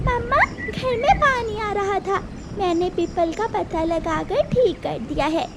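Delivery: big sister taking charge; caring big sister. Acted. Daughter answers mother.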